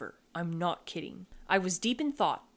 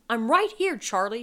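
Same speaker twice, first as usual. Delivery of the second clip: alarmed, raised-voice reassurance; shouted; 3 semitones higher, 5 dB louder than usual. A narrator's reading. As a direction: shout to get through to a panicking child. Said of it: "she shouted".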